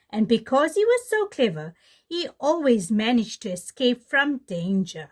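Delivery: put-on lively read-aloud for kids; storybook reading. Teacher reading The Lion and the Clever Little Jackal to children, animated storytelling. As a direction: tell it like a bedtime tale.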